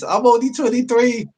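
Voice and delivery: high, giggly quoting of his own comment; laughing at his own joke. Real, not acted. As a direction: say it high and tickled.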